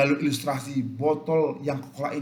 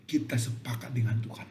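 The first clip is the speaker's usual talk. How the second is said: "kita sepakat dengan Tuhan" whispered; 3 semitones lower, 5 dB quieter than usual. Acted. Preacher whispers the key point to the congregation. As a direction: breathe it to the listeners.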